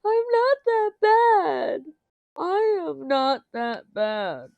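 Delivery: high, slow, loud whiny protest; mock protest. Streamer mocking herself defending against Miss Coffee, high squeaky whine. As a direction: plead in a put-on squeaky voice. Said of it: "It's like".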